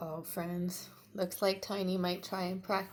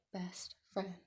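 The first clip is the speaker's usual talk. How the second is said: soft whisper; whispered best friend; 6 dB quieter than usual. Acted. Tiny telling Pointy he is his best friend, whispered. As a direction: breathe out the last words tenderly. Tender ending.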